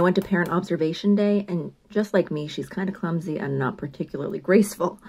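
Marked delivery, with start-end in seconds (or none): none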